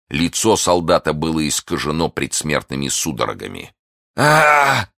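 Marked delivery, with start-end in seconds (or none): 4.2-4.9 loud high "aah" of pain; agonised scream. A narrator's reading. Poisoned soldier on seeing D'Artagnan, agonised cry. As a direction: cry out in dying agony. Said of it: "воскликнул он".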